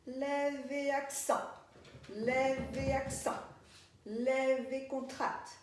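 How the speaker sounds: slow, evenly repeated rhythmic cues; dance-class cueing. Shown as a demonstration. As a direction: chant each move steadily on the count. Calls movement cues in rhythm.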